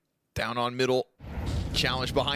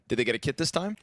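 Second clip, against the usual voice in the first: usual speaking voice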